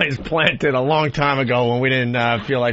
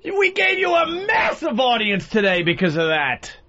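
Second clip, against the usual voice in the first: angry shouting; 10 semitones higher than usual. Acted. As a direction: yell back in outrage. Host yelling back at tape.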